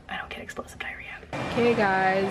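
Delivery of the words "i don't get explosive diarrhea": hushed joking whisper. Real, not acted. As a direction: drop to a whisper for the punchline.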